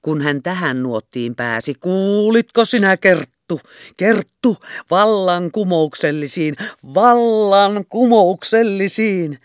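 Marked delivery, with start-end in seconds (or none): 1.7-9.4 loud, high and slow, carried away; excited outburst. A narrator's reading. Captain carried away telling Kerttu, loud excited outburst. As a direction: forget the whisper and burst out. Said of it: "unohti aikoneensa puhua kuiskaavalla äänellä".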